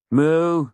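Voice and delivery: loud, high mewing cat cry; cat's meow. Acted. Cat nags owner just sat down, loud high meow. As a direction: imitate a cat demanding attention.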